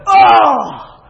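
loud, high mock dismay; hammed-up dismayed "Oh!"; exaggerated groan. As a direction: react like you just heard the worst news. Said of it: "every person's like".

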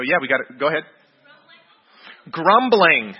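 usual speaking voice

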